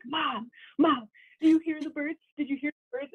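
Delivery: put-on frightened girl calling her mom; freaked-out plea. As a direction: plead urgently about the birds. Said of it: "she's, like, freaking out".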